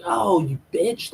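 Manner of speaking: high, drawn-out mock outrage; acted indignation. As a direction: stretch it out, affronted. Acted retort of an angry rival.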